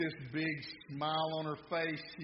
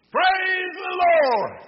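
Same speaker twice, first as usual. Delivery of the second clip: very high, drawn-out joyful holler; elderly church lady's high-pitched holler; exultant shout; 17 dB louder, much slower than usual. Acted. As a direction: shout it to the heavens, stretching every word. Elderly lady praising from her porch.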